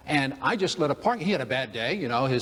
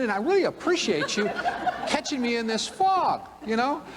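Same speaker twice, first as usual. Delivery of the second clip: mock gracious rambling; 6 semitones higher than usual. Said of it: "I go back and I say".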